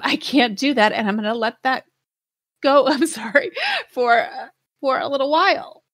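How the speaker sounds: holding back tears, then laughing through them; tearing up. Real, not acted. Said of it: "Oh my God, I'm tearing up".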